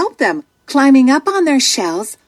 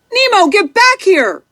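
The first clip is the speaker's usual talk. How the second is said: a worried father's sharp call, pitched up; 8 semitones higher than usual. A narrator's reading. Father yelling after his son near a boat, alarmed call. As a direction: call him back in alarm. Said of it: "cries Marlin".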